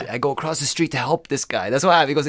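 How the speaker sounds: ordinary talk, nothing marked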